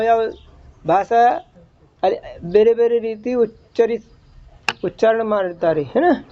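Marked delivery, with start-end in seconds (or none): none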